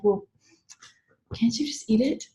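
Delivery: hushed mock reprimand; whispered scolding. A partner hushing her about the steak, whispered. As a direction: hiss it under your breath. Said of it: "And I would be told".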